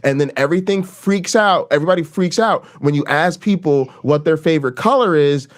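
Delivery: usual speaking voice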